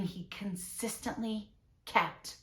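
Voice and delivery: ordinary talk, nothing marked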